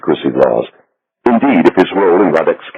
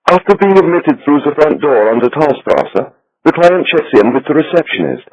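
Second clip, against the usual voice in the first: higher, fussy voice for the banker; little Swiss banker; 8 semitones higher than usual. A narrator's reading. Becker explains procedures.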